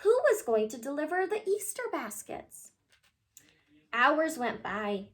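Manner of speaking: ordinary delivery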